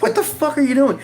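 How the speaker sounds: high-pitched, incredulous shout; outraged yell. Retold or imitated. Patient to nurse who botched the needle, outraged yell. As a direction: demand an answer, voice shooting way up.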